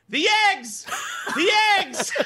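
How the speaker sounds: high, loud anguished yell; hammed-up horrified shriek; retold scream. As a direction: scream it in comic despair. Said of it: "I just screamed".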